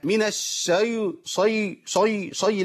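repeated heavy, backed شي; wrong heavy sheen. Shown as a demonstration. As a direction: darken the sh in شي as a mistake. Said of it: "تفخيم الشين والياء".

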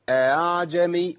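أعجمي slow, the second hamza eased between hamza and alif. Shown as a demonstration. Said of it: "يبقى أقول إيه؟".

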